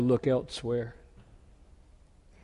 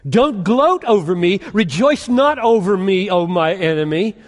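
higher and louder, ringing proclamation; preaching at full force; 6 semitones higher, 8 dB louder than usual. Acted. Preacher proclaiming Micah's hope to the church, full-force preaching. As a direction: thunder the verses out to the congregation.

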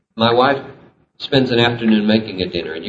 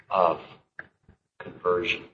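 ordinary delivery

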